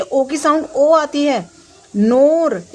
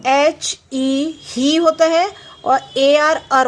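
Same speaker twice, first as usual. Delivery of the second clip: slow spelling out; much slower than usual. Shown as a demonstration. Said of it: "मैं आपको बता रही हूँ".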